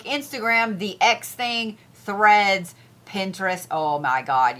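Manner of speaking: usual speaking voice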